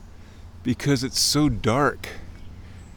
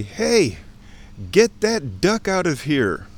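slow, higher-pitched stern command; mock-stern call, drawn out; stern, authoritative voice; 4 semitones higher, slower than usual. Shown as a demonstration. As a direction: order it out with authority. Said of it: "in a loud, stern, but authoritative voice".

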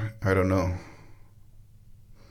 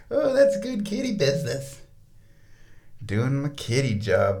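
much higher voice for the cat; high pretend cat voice; cat's voice; 4 semitones higher than usual. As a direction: speak for the cat proudly. Voicing cat's thoughts.

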